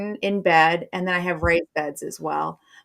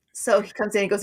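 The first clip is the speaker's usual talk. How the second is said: normal talking voice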